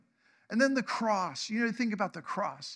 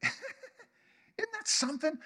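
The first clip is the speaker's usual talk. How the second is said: much higher, slow, laughing marvel; awed wonder; 13 semitones higher, 4 dB quieter, slower than usual. Real, not acted. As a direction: savor the wonder. Preacher marvels after laughing.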